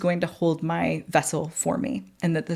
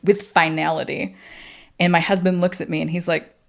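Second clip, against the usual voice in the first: usual speaking voice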